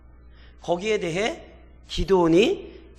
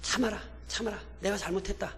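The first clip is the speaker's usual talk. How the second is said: softer calming plea; soothing; 10 dB quieter than usual. Retold or imitated. Gideon calming the angry Ephraimites, soft and soothing. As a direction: soften it to settle them down. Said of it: "기드온이 진정시켜요".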